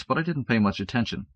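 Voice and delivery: ordinary delivery, nothing marked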